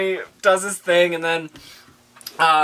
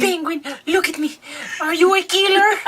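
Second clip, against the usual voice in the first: high-pitched character mother; Penguin's mother; 11 semitones higher than usual. Acted. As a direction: call your son in a high mock-mother voice. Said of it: "his mother part. Go ahead".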